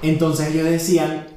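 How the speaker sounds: ordinary talk, nothing marked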